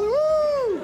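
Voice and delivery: high, loud whoop through laughter; laughing party whoop; laughing woo. Acted. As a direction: cheer again while cracking up.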